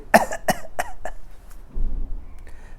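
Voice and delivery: a dry, breathy cough acted out; demonstrated cough. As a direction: cough once for the sick husband. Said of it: "he started coughing".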